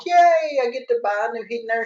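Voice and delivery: a high, sarcastic little yay; mock cheer. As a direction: fake glee, poking fun at a reluctant buyer. Mock customer cheering a purchase.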